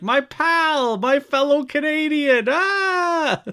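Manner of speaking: high, loud, crying-out joy; gleeful greeting. Retold or imitated. Manager greeting his fellow Canadian, a high gleeful cry. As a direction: gush over a fellow Canadian.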